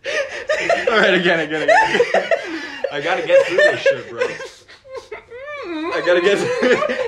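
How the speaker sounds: high, loud words through helpless laughter; hysterical laughing. Real, not acted. Player begging to get through the line, laughing uncontrollably. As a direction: gasp it out while cracking up. Breaks down laughing, asks to retry.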